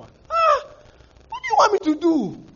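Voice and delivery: high, pleading exasperation; exasperated God. Retold or imitated. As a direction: throw up your hands as God.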